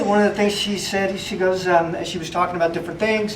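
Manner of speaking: usual speaking voice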